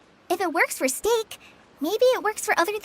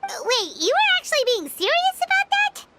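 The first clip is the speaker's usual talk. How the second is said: high, fast disbelieving question; can't believe he meant it; incredulous; 11 semitones higher, faster than usual. Acted. Paimon to Sino about the staff, incredulous.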